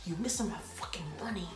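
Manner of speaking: hushed, scolding whisper; imitated whisper. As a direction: cuss someone out under your breath. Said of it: "she's trying to whisper at me".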